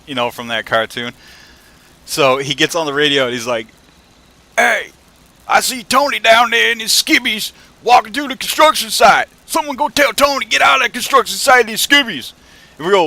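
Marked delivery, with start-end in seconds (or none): 4.6-12.3 put-on loud, high toothless old southerner bellow; screaming southern boss. Old boss yelling into the radio about a worker, mock scream. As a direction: holler it garbled with no teeth, southern. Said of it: "he starts screaming into the radio".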